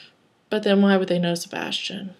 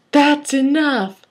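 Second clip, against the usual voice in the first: high, drawn-out commanding yell; 7 semitones higher, 5 dB louder, much slower than usual. A narrator's reading. Sebastian stopping the teasing, high slow yell. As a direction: raise the pitch and stretch it out firmly. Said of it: "That was all caps with an exclamation point".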